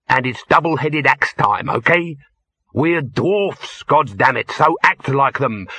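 gruff boss voice ranting at his crew; angry dwarf overseer. Acted. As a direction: bark orders at the lazy miners.

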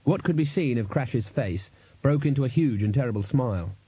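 plain reading voice

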